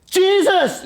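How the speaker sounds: high, loud desperate yell. Retold or imitated. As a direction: cry out the name. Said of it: "I yelled it".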